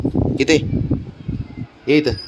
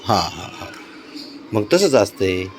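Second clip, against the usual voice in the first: ordinary talk, nothing marked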